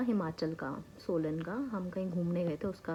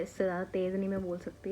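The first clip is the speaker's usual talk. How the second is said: ordinary talk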